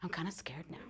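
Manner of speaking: giggly whisper, half afraid; laughing whisper. Retold or imitated. Whispering to husband in bed.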